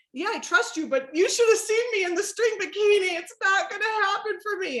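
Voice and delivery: high, whiny tearful protest; retold crying. Doubting client to her trainer, high mock-tearful protest. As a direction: protest in a put-on wail. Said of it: "and I'm crying".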